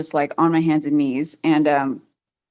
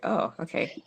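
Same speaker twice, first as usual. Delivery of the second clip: breathy near-whisper, dropped right down; hushed startled oh okay; 2 semitones higher, 8 dB quieter than usual. Retold or imitated. Mother recalling her shaking legs, hushed surprise. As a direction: murmur it to yourself, half-stunned.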